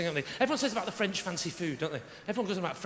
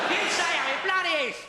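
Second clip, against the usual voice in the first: put-on high, indignant London accent; mock London geezer, pitched up; 8 semitones higher, 3 dB louder than usual. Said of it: "that's how they speak in London".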